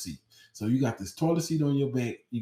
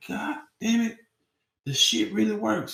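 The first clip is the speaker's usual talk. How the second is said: much higher, squeaky disbelief; shocked squeal; 10 semitones higher than usual. Retold or imitated. Realizing the brownie works, shocked and much higher. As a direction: voice cracks high in shock.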